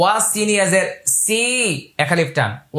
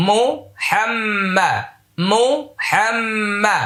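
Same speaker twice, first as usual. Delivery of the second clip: slow, higher, drawn-out chant; dua recitation; 4 semitones higher, much slower than usual. Shown as a demonstration. Teacher recites the dua to students, slow melodic chant. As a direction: intone the prayer, lengthening every vowel.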